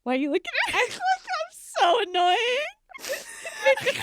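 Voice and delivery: high, laughing protest. Real, not acted. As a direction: laugh through the question.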